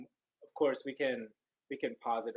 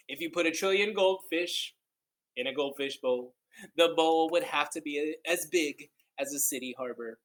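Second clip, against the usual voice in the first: read-aloud storytelling; 5 dB louder than usual. Acted. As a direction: read it like a wondrous tale to kids. Reads picture book aloud.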